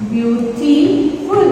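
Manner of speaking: "Beautiful" slowly split as beau-ti-ful; broken into syllables. Shown as a demonstration. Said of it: "Three syllable".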